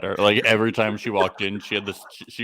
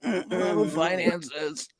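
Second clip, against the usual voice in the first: higher, drawn-out mock moans; mock amorous moaning; 8 semitones higher, 5 dB quieter, much slower than usual. Couple turned on by business talk, mock moaning. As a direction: moan it like making out. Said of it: "start making out immediately".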